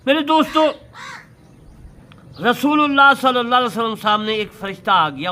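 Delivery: impassioned sermon address; preaching at full force. Acted. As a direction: lift the voice and call out to the listeners. Preacher raises voice to congregation.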